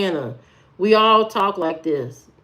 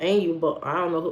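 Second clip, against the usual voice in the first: normal talking voice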